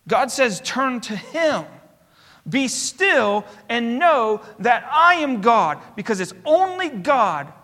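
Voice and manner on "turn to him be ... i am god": slow and measured, stress on "I"; solemn quote. Acted. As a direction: let every word land slowly. Quoting God, slow and solemn.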